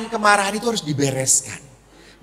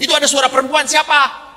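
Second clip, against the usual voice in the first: high-pitched accusing mock demand; imitated jealous wife; 6 semitones higher, faster than usual. Wife hears a woman on the phone, high mock accusation. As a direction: pounce on him as the suspicious wife.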